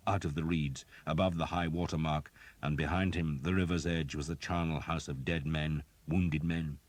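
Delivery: usual speaking voice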